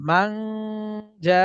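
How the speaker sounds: মান hummed, জা stretched very long; slow recitation with drawn-out জা; ghunnah and long madd. Shown as a demonstration. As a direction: hold the nasal in মান, then prolong the vowel of জা. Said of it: "যাতে কয় আলিফ টান?".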